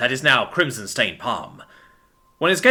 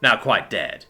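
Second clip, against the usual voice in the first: usual speaking voice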